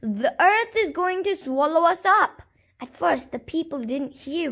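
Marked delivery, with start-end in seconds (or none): 0.0-2.4 raised voice, calling across the street; loud warning cry. A narrator's reading. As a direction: call it out to the whole town. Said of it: "called out".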